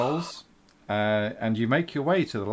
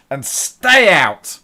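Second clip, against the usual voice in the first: a slow, booming shout of a big burly woman, much louder and higher; burly woman bellowing; 7 semitones higher, 14 dB louder, much slower than usual. Acted. Big burly woman throws a drunk out, slow booming shout. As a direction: throw him out with a roar. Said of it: "you see a big burly woman just".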